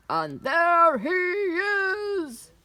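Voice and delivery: high, loud, drawn-out discovery; roaring giant. A narrator's reading. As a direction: roar slowly, rising on spotting the prey. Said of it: "roared the giant".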